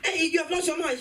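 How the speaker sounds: hammed-up mom losing it at her son; outraged mom. Mom yelling at her lying son, high outraged voice.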